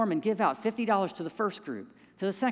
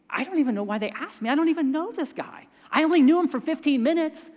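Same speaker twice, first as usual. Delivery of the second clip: incredulous protest; 6 semitones higher, 4 dB louder than usual. Retold or imitated. Protesting to wife about funeral.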